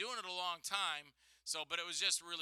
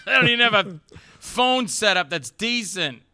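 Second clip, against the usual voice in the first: louder, exasperated mock rant; mock complaint; 6 semitones higher, 20 dB louder than usual. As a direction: play the annoyed caller. Mocking the show's phone setup.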